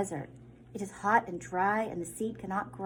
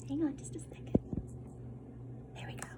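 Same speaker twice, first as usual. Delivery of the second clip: higher whispered aside; 4 semitones higher, 8 dB quieter, much faster than usual. Real, not acted. Teacher asking the class to wait, whispered. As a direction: breathe it as a quick aside. Fixing equipment mid-reading.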